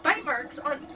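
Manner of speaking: ordinary talk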